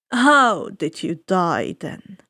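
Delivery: slow, low, smooth villain voice; suave ferryman. Acted. Ferryman of the dead greeting the boy, slow and silky. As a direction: savor each word like a sly host. Charon asks.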